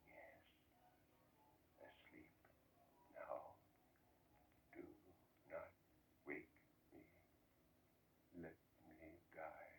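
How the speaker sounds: faint, breathless whisper from the deathbed; dying man's ghostly whisper; barely audible whisper. Acted. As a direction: breathe the words out, almost nothing. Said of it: "in a barely audible whisper".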